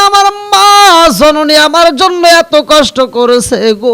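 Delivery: high, loud chanted cry for mother; weeping wail. Acted. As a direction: wail it out as the acted grieving son. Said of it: "যুবক কান্দে আর কয়".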